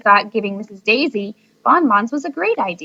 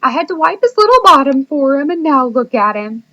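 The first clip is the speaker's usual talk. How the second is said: blubbering; 5 semitones higher, 6 dB louder than usual. A narrator's reading. Teacher blubbering to the class about her son growing up. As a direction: gush through tears over her baby. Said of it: "She started crying".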